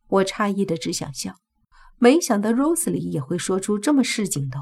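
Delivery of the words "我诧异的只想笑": plain reading voice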